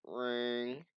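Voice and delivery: usual speaking voice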